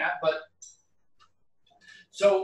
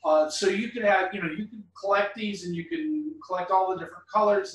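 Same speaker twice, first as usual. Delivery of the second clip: normal talking voice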